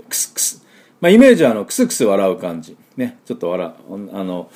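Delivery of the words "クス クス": soft lifted K running into S; voiceless X sound. Shown as a demonstration. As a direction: whisper two k-s bursts. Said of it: "持ち上げる音とSの音が入って". (声を出さないクス、クス)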